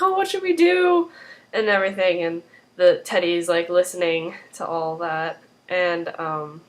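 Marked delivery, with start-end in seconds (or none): 0.0-1.1 high-pitched imitation of a fretting wife; mock distress. Wife answers her worried husband, in high mock distress. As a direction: act out a helpless, worried plea. Wife's alarmed reply, acted.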